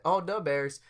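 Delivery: lightly hammed Midwest accent; Chicago accent. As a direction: imitate a Chicago native, almost Wisconsin. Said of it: "almost Wisconsin".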